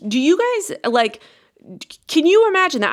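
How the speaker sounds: amazed. Real, not acted. Amazed aside about a reality star.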